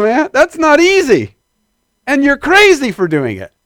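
impassioned pulpit outcry; fervent exclamation. Real, not acted. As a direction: cry out how hard it is. Preacher marvels at hard teaching.

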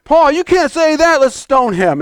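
mild mock outrage, jumping high at first; outraged crowd. Crowd turning on Paul, acted indignation.

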